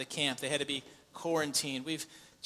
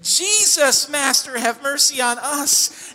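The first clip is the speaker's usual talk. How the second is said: loud, higher crying out to the Master; loud plea; 8 semitones higher, 10 dB louder, slower than usual. Retold or imitated. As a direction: plead at the top of the voice. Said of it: "They say".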